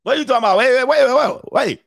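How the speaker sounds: high, rattled disbelief; comic high-pitched double take; flabbergasted dad. Acted. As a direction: pile up the words in mock alarm. Dad stunned by kid's answer.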